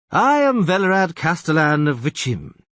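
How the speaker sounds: pompous castellan. Acted. Castellan introduces himself loudly.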